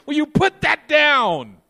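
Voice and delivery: slow, high, loud command; stern shout. Acted. Jesus ordering the believer to drop his polluted water, in a slow stern shout. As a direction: bark it slowly, like you mean it. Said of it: "And he's saying".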